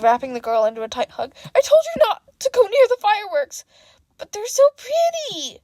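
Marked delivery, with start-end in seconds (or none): 1.5-3.6 high, loud scolding; scolding mother. A narrator's reading. Mother scolding her daughter for going near fireworks, high and loud. 4.2-5.6 put-on high little-girl protest; little girl arguing. A narrator's reading. Little girl answering her mother's scolding, high childish protest. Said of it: "the little girl argued".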